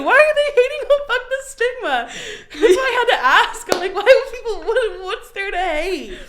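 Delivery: high, loud, laughing outcry; laughing disbelief. Real, not acted. Laughing outrage at haters.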